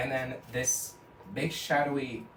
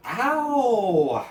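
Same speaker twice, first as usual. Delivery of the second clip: put-on loud high baby-like "ow"; hammed-up crybaby yelp; babyish yelp; 9 semitones higher, 11 dB louder than usual. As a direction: howl like a hurt little kid. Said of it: "he acts like a baby".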